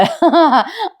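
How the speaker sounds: short bright laugh; a real chuckle breaking out; amused laugh. Real, not acted. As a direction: laugh at the hero's fix. Narrator laughs at Arjun's trouble.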